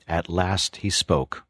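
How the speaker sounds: ordinary delivery, nothing marked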